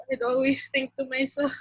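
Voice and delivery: ordinary delivery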